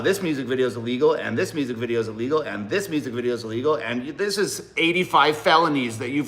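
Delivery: fast, repetitive rattled-off charges; rapid official listing. Pretend. Border officer going through his music videos, rapid listing. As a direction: tick off offences one after another quickly.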